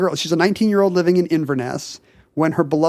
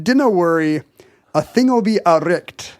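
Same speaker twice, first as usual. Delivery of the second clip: reassuring Scottish dad; slow Scottish dialect from a comforting father; Scottish accent. Retold or imitated. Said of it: "because he was Scottish".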